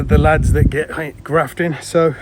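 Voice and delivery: ordinary talk, nothing marked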